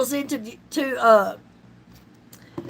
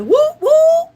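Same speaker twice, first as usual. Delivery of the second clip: much higher and louder whoop; retold cheer; 12 semitones higher, 8 dB louder than usual. Reacting to the verified email, cheering whoop. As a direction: cheer at the good news. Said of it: "I said".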